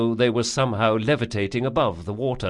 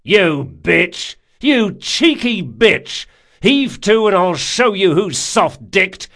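furious high-pitched bellow, punching the words; outraged shout; 9 semitones higher, 9 dB louder, slower than usual. A narrator's reading. Galley man threatening the cheeky girl, outraged shout. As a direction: roar threats at an insolent rower.

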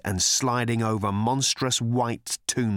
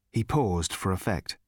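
ordinary delivery, nothing marked

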